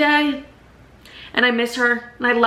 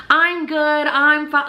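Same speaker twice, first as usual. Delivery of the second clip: imitating his chipper reply, pitched higher; chipper and cheery; 6 semitones higher, slower than usual. New man answers her check-in call, chipper and cheery. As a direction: play him breezily cheerful, pitch lifted. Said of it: "he's chipper and cheery".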